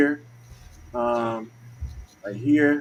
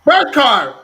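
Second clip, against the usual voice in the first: loud, high-pitched hollering; rowdy shout to get everyone ready; excited call-out; 8 semitones higher, 10 dB louder than usual. Real, not acted. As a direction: call it out big, breaking into laughter. Calls out excitedly for birth cards.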